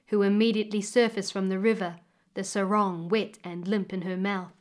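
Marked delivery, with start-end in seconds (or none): none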